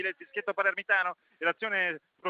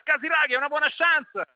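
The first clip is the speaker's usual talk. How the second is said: excited; 8 semitones higher, 5 dB louder than usual. Real, not acted. Chance for Ancona.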